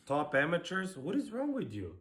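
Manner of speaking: high, loud outraged questions; real indignation. Real, not acted. Dancer scolding a judge for slighting amateurs, outraged. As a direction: challenge her, pitch leaping up in disbelief. Argues back at judge's harsh remark.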